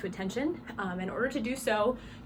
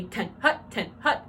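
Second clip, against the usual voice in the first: TEN and HUT cut short with gaps between; short and sharp; 2 semitones higher, 4 dB louder, slower than usual. Shown as a demonstration. Coach shows drum majors the right call, short and sharp. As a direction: snap out "Ten hut", each word short. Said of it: "needs to be short and sharp".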